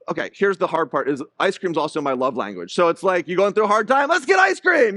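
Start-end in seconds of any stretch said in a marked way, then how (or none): 3.3-5.0 excited dad offer. Retold or imitated. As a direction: pitch it up like a thrilled dad. Dad's ice cream love language.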